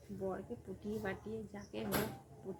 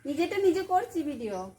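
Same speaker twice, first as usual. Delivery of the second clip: loud explaining; 7 semitones higher, 13 dB louder than usual. Real, not acted. To the father-in-law who keeps asking about the camera, loud explanation. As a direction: say it loud so he finally gets it.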